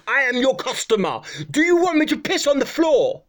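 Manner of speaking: high mock indignation; indignant customer. Customer protesting to the garage owner, high mock indignation.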